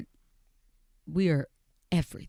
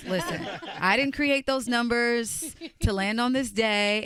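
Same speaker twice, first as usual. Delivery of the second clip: higher, drawn-out take on a friend's way of talking; a hint of an affected, higher-pitched friend; sounding like friend; 7 semitones higher than usual. Real, not acted. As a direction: slip lightly into your friend's voice. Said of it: "why am I talking like that".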